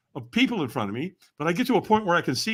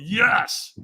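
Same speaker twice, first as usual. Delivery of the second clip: higher, more forceful yes; stronger yes; 5 semitones higher, 3 dB louder than usual. Retold or imitated. Walker answers trainer's demand for more, stronger yes. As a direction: put more drive into the yes.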